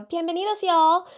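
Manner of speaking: high, loud bilingual welcome; podcast intro greeting. Retold or imitated. Podcast host quoting how she opens episodes, high loud greeting. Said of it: "at the beginning of these podcast episodes, I go".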